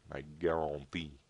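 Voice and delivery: a slow, hammed-up French accent; mock French, drawn out. Caster jokes in a French accent. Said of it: "I was doing the French accent".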